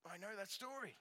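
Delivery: the grad thinking it to himself, a touch excited; inner eager thought. Retold or imitated. Junior grad recalling his thought in the meeting, lightly eager. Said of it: "But I'm like".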